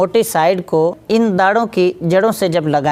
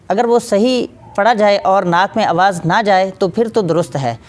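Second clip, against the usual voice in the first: ordinary talk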